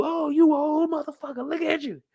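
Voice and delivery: high, mocking comeback; high taunt. Imitating Wanda clapping back, high and mocking. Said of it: "she going back to".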